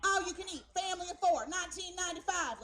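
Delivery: high, loud, separate bursts; blinking sign. Retold or imitated. Lighted Southern restaurant sign listing facts, punched out item by item. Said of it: "sign that just blinks facts".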